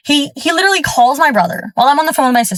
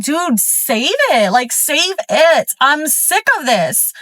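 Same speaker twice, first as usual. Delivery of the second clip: slow, drawn-out retold brush-off, pitched up; fed-up dismissal; 4 semitones higher, much slower than usual. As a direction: drag out each word, sick of the excuses. Retold fed-up reply to partner.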